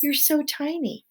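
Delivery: high, cooing princess voice; sweet princess. A narrator's reading. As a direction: coo it high in delight. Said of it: "said the princess to Despereaux".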